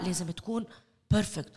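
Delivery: ordinary delivery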